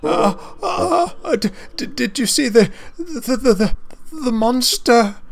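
very high, gasping little engine voice; panicky stammer. A narrator's reading. Percy asking Mavis about the monster, high panicky stammer. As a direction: trip over the words, whimpering with worry.

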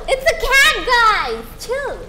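high-pitched shout after a laugh; amused loud protest. Real, not acted. Streamer protesting to viewers over the cat's name, high amused shout. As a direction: yell it out in exasperated amusement.

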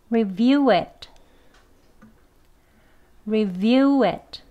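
review it said slowly, it full and clear; drawn out, every sound of review it clear; strong form. Shown as a demonstration. Teacher drills the strong form with students. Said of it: "강형 발음".

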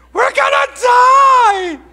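hammed-up shriek of terror, very high and loud; panicked scream. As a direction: scream it in comic panic, drawn out. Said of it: "they started screaming".

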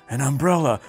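usual speaking voice

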